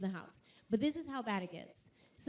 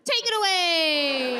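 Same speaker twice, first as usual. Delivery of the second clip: hammed-up shout; 5 semitones higher, 11 dB louder, slower than usual. Handing off to the band.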